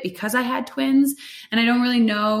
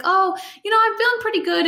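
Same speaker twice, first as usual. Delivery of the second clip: much higher, breezy and carefree; 12 semitones higher, 4 dB louder than usual. Retold or imitated. To the nurses, breezily brushing off the pain. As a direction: toss it off like nothing hurts.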